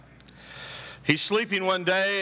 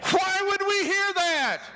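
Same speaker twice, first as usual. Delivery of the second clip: preaching peak. Real, not acted. Preacher peaks at full force.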